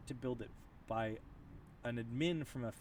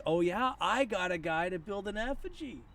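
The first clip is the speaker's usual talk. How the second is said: high, slow, louder mocking brag; gloating troll; 8 semitones higher, 6 dB louder, slower than usual. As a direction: ham up a smug troll boasting over champagne. Imagining gloating Russian trolls.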